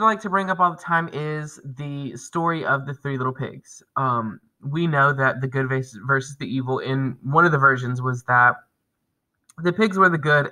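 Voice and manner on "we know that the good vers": usual speaking voice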